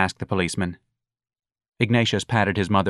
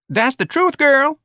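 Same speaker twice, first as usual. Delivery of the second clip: very high, loud agreement; woman in crowd; 6 dB louder, slower than usual. A narrator's reading. Woman answering from the crowd, high emphatic agreement. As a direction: call out support in a high voice.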